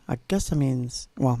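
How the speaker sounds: usual speaking voice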